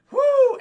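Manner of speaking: excited high-pitched "whoo"; high, loud whoop. Real, not acted. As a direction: let out a big whoop. Spontaneous whoop during recap.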